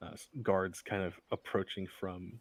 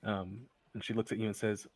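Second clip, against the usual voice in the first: normal talking voice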